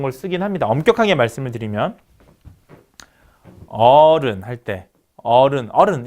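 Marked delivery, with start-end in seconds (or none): 3.7-5.7 "어른" with a long, drawn-out 어; 장모음 어. Shown as a demonstration. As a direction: hold the first vowel of "어른". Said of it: "우리가 좀 길게 발음하는". ("어른"의 "어"를 길게)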